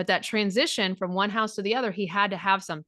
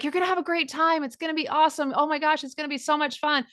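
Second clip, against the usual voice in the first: much higher, gushing fake enthusiasm; fake hype; 6 semitones higher than usual. Parent hyping up dad's house to the kid, over-the-top fake excitement. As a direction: oversell it like a parent faking cheer. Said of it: "Are any of you the fake".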